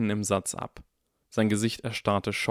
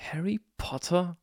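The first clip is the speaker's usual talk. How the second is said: higher, hushed disbelief; gasped; 9 semitones higher than usual. A narrator's reading. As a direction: gasp the name in astonishment. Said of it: "keuchte Draco".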